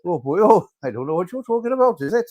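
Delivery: mock Welsh grumbling; poor Welsh accent. Host still mocking the Welsh in a poor Welsh accent. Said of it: "poor Welsh accent".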